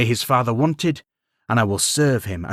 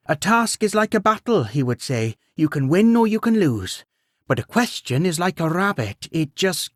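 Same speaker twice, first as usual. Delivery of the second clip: gentle queen voice; 7 semitones higher than usual. A narrator's reading. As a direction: speak as a weary, kindly queen. Queen quotes her late husband.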